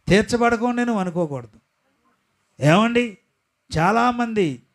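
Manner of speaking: high, loud, emphatic pulpit voice; full-force preaching. Acted. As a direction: drive each phrase home.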